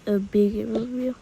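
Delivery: usual speaking voice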